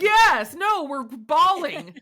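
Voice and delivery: high, loud outburst over the bawling; excited burst. Real, not acted. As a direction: blurt it out with a rush of emotion. Recalling crying while dad recorded.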